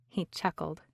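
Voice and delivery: usual speaking voice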